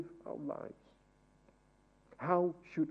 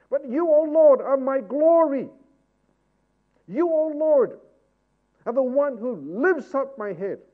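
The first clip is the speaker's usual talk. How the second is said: higher, louder preacher's exhortation; full-force preaching; 9 semitones higher, 13 dB louder than usual. Acted. Preacher exhorting his church to pray, fervent. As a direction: drive the congregation to pray boldly.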